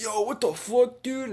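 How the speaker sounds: high, loud, drawn-out yell; outraged brother. Acted. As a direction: yell in shocked protest. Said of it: "His brother's like".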